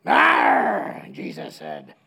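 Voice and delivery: a hint of a pirate voice, unvoiced; lightly voiced pirate "Arr"; mock pirate grunt; pirate growl. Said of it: "or the pirate guy".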